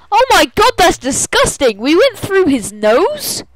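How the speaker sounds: high, loud squawk of revulsion; disgusted disbelief. Real, not acted. Game master grossed out by the story, loud disbelief. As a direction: exclaim loudly, voice swooping up in disgust.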